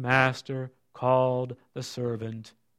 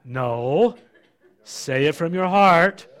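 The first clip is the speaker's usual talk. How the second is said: firm parent; 3 semitones higher, 5 dB louder, slower than usual. Acted. Parent correcting a child.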